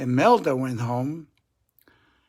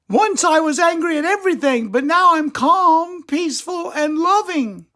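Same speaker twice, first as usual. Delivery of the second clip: very high, sweet little squirrel voice; calm squirrel lady; 13 semitones higher, 6 dB louder than usual. Acted. Squirrel greets Attila at the club, very high voice. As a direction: play a tiny serene squirrel. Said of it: "said Angelica".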